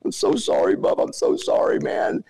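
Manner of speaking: high, breathy sobbing sorry; mock crying apology. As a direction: whimper it like a scared kid. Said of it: "he's crying, going".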